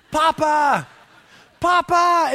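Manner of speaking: put-on high, loud toddler calling out for Dad; imitated child's yell. Son waking parents before dawn, mock yelling. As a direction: holler it like a small boy upstairs. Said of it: "he yells".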